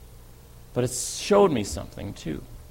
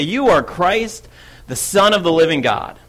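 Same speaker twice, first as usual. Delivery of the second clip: louder, higher, drawn-out declaration; eager proclamation; 5 semitones higher, 5 dB louder than usual. Acted. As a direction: proclaim it boldly, like the first to answer. Peter blurts out his answer eagerly.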